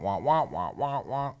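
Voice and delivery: muffled trombone wah wah; droning teacher noise like a muted horn; Charlie Brown teacher wah-wah. Retold or imitated. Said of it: "It sounds like the girl off, uh, Charlie Brown".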